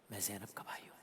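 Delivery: hushed whisper, breaking inward; grieving whisper. Retold or imitated. Preacher voicing the Imam to tribe, whispered. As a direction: whisper it as if grief takes the voice. Imam's grieving plea retold.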